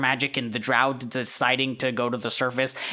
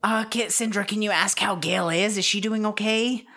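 higher-pitched character voice; Wendy; 8 semitones higher than usual. Acted. Friend checking on Gale by message, higher voice. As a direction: ask lightly in a raised voice. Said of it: "Wendy says".

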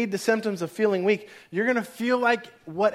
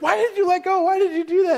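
very high, raised-voice protest; mock yelling child; 11 semitones higher, 5 dB louder than usual. Daughter to dad after bike stop, mock outraged yell. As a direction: imitate an upset kid yelling. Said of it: "she yells at you".

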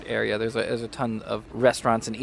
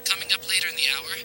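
news anchor read; 6 semitones higher than usual. Acted.

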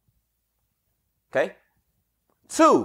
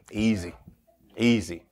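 low, soft, coaxing criminal voice; criminal sizing you up; 13 semitones lower, 6 dB quieter than usual. Retold or imitated. Street criminal calming a mark he's chosen, low and soft. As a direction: lure the mark in quietly and low. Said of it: "Criminal like".

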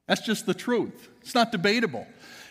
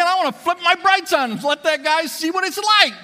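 much louder, higher, laughing mock fury; inner vengeful thought; 9 semitones higher, 8 dB louder than usual. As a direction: blurt the petty revenge thought with glee. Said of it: "But I'm thinking".

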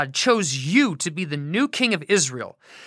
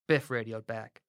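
plain reading voice

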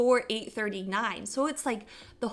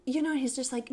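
ordinary talk, nothing marked

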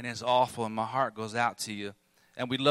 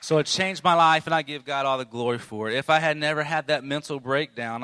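loud, ringing testimony to the congregation; forceful preaching; 3 semitones higher, 7 dB louder than usual. Acted. Pastor testifying to the church, loud and ringing. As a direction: proclaim it, preacher at full force.

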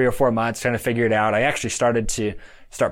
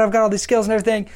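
much higher and fast, tumbling out eagerly; excited rush; 9 semitones higher, faster than usual. Retold or imitated. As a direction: rattle off your skills, thrilled. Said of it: "I'm so excited".

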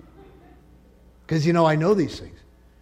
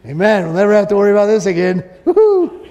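fast, much louder, ending in a high whoop; excited cheer; 5 semitones higher, 8 dB louder than usual. Acted. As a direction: celebrate with the congregation. Joyful cheer ending point.

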